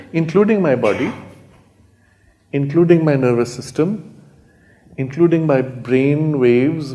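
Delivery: rising insistence on "including", slowed on the last; stress on including. Real, not acted. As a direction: build the list, punching "including" every time. Building list of what holds divine.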